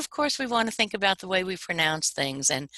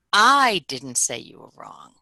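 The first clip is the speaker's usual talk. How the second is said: "I" drawn out and hit loud, rest falls away; stress on I; 6 dB louder, slower than usual. Shown as a demonstration. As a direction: punch a long, loud "I". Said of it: "first time I'll emphasize I".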